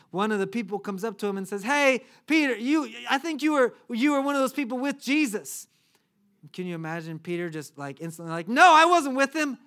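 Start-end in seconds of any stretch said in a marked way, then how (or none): 1.6-5.7 raised, higher voice of a bystander; acted accusation. Bystander accuses Peter in acted scene. 8.5-9.6 loud, high protest; emphatic denial. Acted. Peter's instant denial, acted.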